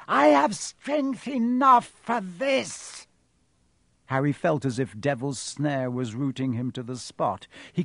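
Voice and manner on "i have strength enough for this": high, loud, slow and commanding. A narrator's reading. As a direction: insist with drawn-out menace. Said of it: "The high voice spoke again".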